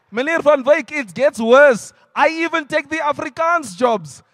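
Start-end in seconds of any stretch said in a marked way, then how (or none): none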